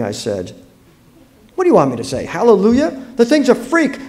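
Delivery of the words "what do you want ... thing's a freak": high, loud, mounting exasperation; exasperated outburst. Acted. Wife fed up with the pet argument, exasperated. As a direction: argue back at full pitch. Acted quarrel over the new pet.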